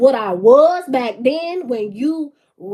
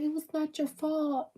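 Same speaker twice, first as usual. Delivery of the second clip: soft reassurance; 2 semitones higher, 13 dB quieter than usual. Retold or imitated. Retelling Michael comforting someone.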